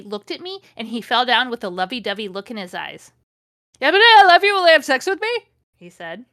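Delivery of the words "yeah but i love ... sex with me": high, loud blurted confession; frantic love outburst. Acted. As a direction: blurt it out in a hammed-up frenzy. Blurted love declaration in story.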